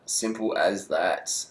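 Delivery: "Simple as that" slow and quiet, drawn out; slow emphatic repeat. Real, not acted. Wrapping up his Froome prediction, slow emphatic repeat. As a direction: let "Simple as that" land slowly and softly.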